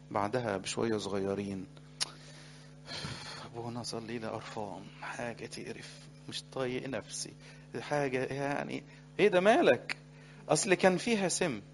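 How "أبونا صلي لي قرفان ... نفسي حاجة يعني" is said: much softer, disgusted complaint; quietly voiced weary youth; mock fed-up mumble. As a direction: sound sick of yourself.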